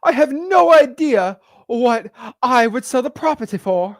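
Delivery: high, slowed mock Victorian accent; a seller playing coy in a fake Victorian accent; drawn-out, high fake Victorian accent. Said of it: "fake Victorian accent".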